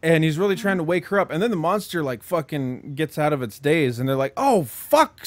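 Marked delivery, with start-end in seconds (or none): 4.3-5.2 alarmed. Retold or imitated. Retold alarm, very high.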